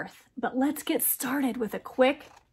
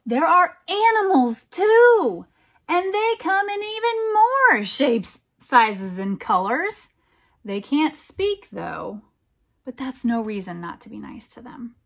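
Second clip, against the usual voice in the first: gentle, lilting read-aloud voice; storybook narration for a little one; reading to a child; 3 semitones higher, 4 dB louder than usual. Acted. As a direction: read it like a bedtime picture book. Reads a picture book aloud.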